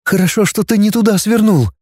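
high, mildly anxious voice; trembling voice. Acted. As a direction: keep it high with a touch of tremble. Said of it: "дрожащим голосом сказала Наташа". (высоко, с лёгкой дрожью)

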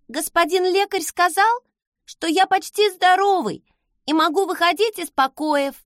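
put-on eager young child, pitched high; little boy. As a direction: report proudly like a little boy.

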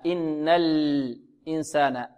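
الإنسان slow, with a clear unvowelled lam; clear still lam. Shown as a demonstration. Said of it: "واحرص على السكون". (إظهار سكون اللام)

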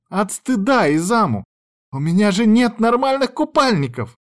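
high, dramatic lament; overacted distress. A narrator's reading. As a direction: wail the complaint theatrically. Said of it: "Ты сейчас переигрываешь".

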